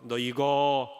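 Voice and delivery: low, slow menacing warning; threatening housekeeper. Acted. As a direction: hold up the threat darkly. Housekeeper threatens once more. (낮게 위협하는 가정부)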